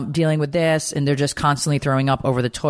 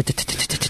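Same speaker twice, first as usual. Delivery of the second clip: pattering feet; 6 dB quieter, faster than usual. Retold or imitated. Imitating little feet running.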